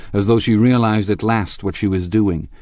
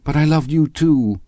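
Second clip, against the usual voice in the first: raised, tearful confession; helpless sobbing, acted; 7 semitones higher, 5 dB louder than usual. A narrator's reading. Daisy admits to Gatsby she loved both, tearfully. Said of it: "She began to sob helplessly".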